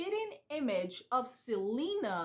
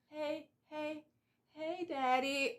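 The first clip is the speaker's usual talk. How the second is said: put-on drowsy drunk; 4 semitones higher, slower than usual. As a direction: mimic a tipsy wake-up. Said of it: "and she's like".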